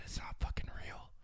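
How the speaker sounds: secret whispered in the ear. Retold or imitated. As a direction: whisper it very softly, no voice. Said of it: "I whisper in his ear".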